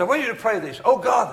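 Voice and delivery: high, loud prayer call; declaimed prayer. Retold or imitated. Preacher leading packed church in prayer, declaimed loudly. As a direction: launch the prayer out over the crowd.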